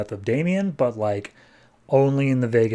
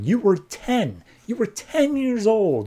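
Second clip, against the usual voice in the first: mock emotional plea; 9 semitones higher, slower than usual. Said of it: "Tim just breaks down".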